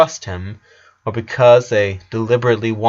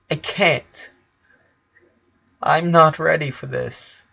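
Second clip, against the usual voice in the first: choked up; 5 semitones higher, slower than usual. A narrator's reading. Lincoln fighting back tears.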